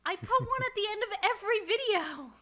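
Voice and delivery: insist, fed up at not being heard; exasperated insistence. Real, not acted. Repeats herself in plug quarrel.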